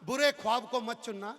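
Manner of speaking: slow, measured recital; poem recitation. Real, not acted. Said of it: "ये चार पंक्तियां ले लेना".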